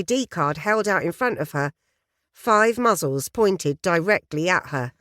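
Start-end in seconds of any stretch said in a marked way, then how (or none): none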